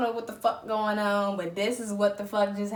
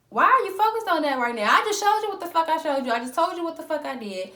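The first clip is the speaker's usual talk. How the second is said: loud, high, fast frustrated outburst; you can't believe his priorities; exasperated; 8 semitones higher, 5 dB louder, faster than usual. Retold or imitated.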